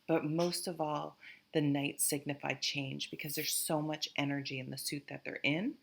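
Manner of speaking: normal talking voice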